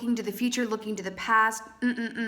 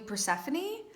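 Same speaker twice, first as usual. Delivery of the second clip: "Persephone" rising at the end like a question; 6 semitones higher, 5 dB quieter than usual. Real, not acted. Tarot reader naming the goddess the pomegranates suggest, questioning. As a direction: lift the end of "Persephone" as if unsure. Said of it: "when I say that like a question".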